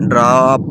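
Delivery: ڈراپ with a long open AA; long AA sound. Shown as a demonstration. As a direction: stretch the AA in ڈراپ, as "draap". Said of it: "الف مد آ کی آواز دے رہا ہے". (ڈراپ میں الف مد آ کی لمبی آواز)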